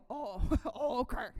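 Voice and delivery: mock tough Pacino, laughing through; hammed-up Scarface with giggles. Answering Michael's sass with a hammed-up Al Pacino. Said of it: "my Al Pacino impression".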